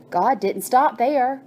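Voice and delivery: ordinary delivery, nothing marked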